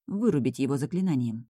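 ordinary delivery

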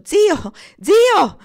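very high, very loud panicked cries; terrified screams. A narrator's reading. Boy on the sinking boat calling his uncle, terrified screaming. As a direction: scream for help, rising in terror. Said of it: "Grida terrorizzate".

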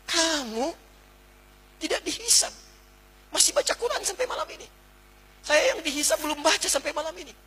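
high, playful scolding of oneself; teasing self-reproach. Retold or imitated. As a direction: tease yourself while pointing at the speaker. Talks to himself pointing at speaker.

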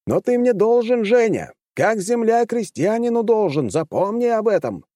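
shouted down a corridor; shouting. A narrator's reading. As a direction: yell it after him. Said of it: "пришлось буквально выкрикнуть".

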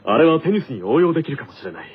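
a lightly voiced, measured captain musing after a film; captain unwinding with a teammate, lightly voiced and calm; team captain character. In-character radio skit.

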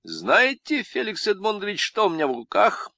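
high, sly Lenin impression. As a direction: play a crafty Lenin. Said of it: "по-ленински".

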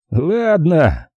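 lightly amused concession; smirking. A narrator's reading. Agent agreeing to the plan, mildly smirking. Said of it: "усмехнулся Крис".

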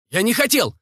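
high-pitched pleading excuse; high frantic denial; desperate shout. A narrator's reading. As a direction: cry it out in protest, high. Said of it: "закричал Евгений".

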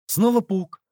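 ordinary delivery

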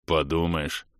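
low offended puff; huffy dwarf. Acted. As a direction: huff it like a sulky dwarf. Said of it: "обиженно пропыхтел гном". (обиженное пыхтение гнома)

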